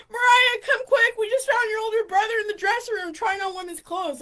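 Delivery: excited shout, easing slightly toward the end; urgent calling out. Retold or imitated. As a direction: imitate a friend urgently summoning someone. Friend calls her urgently in story.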